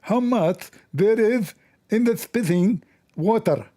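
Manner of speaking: speaking like Zakir Naik to fool the AI; imitating Zakir Naik. Shown as a demonstration. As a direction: ask it slowly in a mock preacher voice. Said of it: "I will speak like a Zakir Naik".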